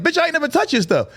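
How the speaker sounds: very high indignant denial; mock-outraged, pitch way up; arguing. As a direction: imitate a woman snapping back in a row. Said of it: "they get to arguing".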